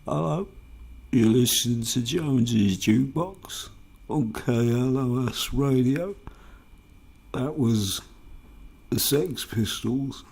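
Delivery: loud, put-on DJ announcement; radio DJ. DJ back-announcing the Sex Pistols, loud mock radio voice. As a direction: project it like a mock jukebox show host.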